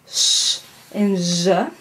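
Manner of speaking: sh as a bare hush, zh with a voiced buzz; sh drawn out without voice, then zh voiced with a short uh; voiceless, then voiced. Shown as a demonstration. Teacher contrasting two fricatives, sh unvoiced and zh buzzed. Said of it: "the voiceless one".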